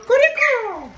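high, loud singsong squawk; parrot-style calling. Real, not acted. Owner egging on the parrot, high loud mimicking call. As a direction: squawk the words to a bird.

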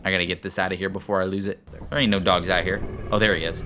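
usual speaking voice

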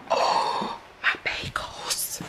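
breathless whispered dismay. Real, not acted. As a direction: whisper it in shock as you see the damage. Discovers burnt bagels, whispering.

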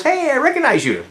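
high-pitched imitated hail; mock excited greeting. Somebody at a Chevy event spotting him, mock excited greeting. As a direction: imitate someone spotting a familiar face. Retold stranger hailing him.

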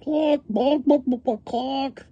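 very high, rapid-fire clucks; hens clucking. A narrator's reading. Hens in the yard, high squawky clucking. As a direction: cackle and cluck like a hen. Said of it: "are saying".

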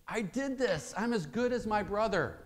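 put-on high, insistent child; high boyish plea; pleading boy. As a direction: push it up, the son near tears. Son seeking validation.

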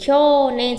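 "kyonen" with the o drawn out; long vowel. Shown as a demonstration. Teacher explaining the mistake, "kyonen" with long o. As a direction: hold the o in "kyonen". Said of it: "sẽ có trường âm".

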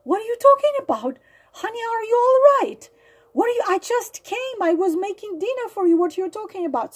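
high, fast mock-innocent husband; gaslighting husband feigning surprised concern for his wife; faked innocence. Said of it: "he turns to her with his big eyes and he says".

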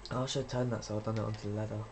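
ordinary talk

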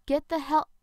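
loud urgent yell, cut off. Acted. Zoe warning Rob of the creature, loud yell. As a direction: shout a warning at Rob before the creature moves. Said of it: "I yelled".

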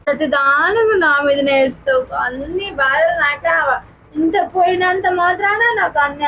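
slow, tearful reproach; tearful complaint. Real, not acted. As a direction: complain through tears, softening and dragging the words. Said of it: "ఏడవకే". (ఏడుస్తూ బాధగా నిలదీయడం)

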